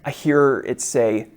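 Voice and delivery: normal talking voice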